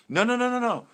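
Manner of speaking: loud, high string of no's to cut in; emphatic no. Real, not acted. Cutting in on the listener, loud and high. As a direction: stop the other person with a rising flurry of no.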